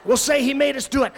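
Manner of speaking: put-on loud boyish scheming; hammed-up camp kids plotting; mock boys egging each other on; loud conspiratorial. Guys plotting, loud acted quote.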